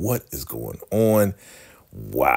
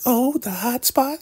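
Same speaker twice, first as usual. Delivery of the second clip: very high, coy falsetto question; imitating a sweet-talking woman, intrigued; soft, sweet woman. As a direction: play it flirty in a mock-female falsetto. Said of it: "both talking real soft and sweet".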